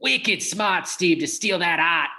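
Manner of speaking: teasing imitation of Boston speech; mock Boston accent. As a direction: drop the r's like a Bostonian. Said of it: "That's a Boston accent".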